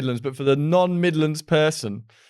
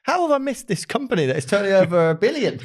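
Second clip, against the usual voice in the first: quick, higher-pitched mock astonishment; retold disbelief; 7 semitones higher, faster than usual. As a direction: quote your own amazed reaction in a rush. Retells own surprise at missing company.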